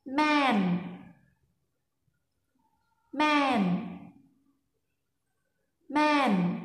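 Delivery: "Man" high and level; Lao mai ek tone. Shown as a demonstration. Teacher models the tone for students. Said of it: "các bạn phát âm theo cô nhá".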